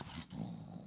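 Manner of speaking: normal talking voice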